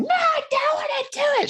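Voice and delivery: put-on high, whiny three-year-old refusing; high little-girl whine; whiny toddler daughter. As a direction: squeak it out like a stubborn toddler. Daughter voiced refusing toothbrushing.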